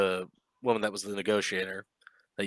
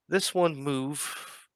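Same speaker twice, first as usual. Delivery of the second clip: slow drawn out; 6 semitones higher, much slower than usual. Acted. Baron singles out a player.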